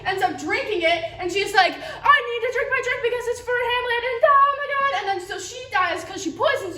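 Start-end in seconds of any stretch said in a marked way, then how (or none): 1.7-4.9 high, melodramatic mock panic; hammed-up queen. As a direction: go high, building to a loud comic cry. Acts out queen drinking poison.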